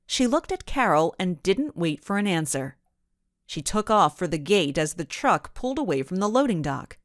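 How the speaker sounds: ordinary delivery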